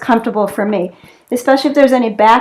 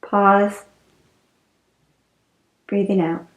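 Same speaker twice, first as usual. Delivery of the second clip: guided relaxation; 3 semitones lower than usual. Real, not acted. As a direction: ease them into the breath, unhurried and settling. Leads restorative breathing relaxation.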